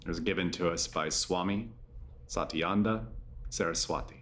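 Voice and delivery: low, measured yoga nidra voice with long pauses; guided meditation. Acted. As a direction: guide them gently into rest, low-pitched and unhurried.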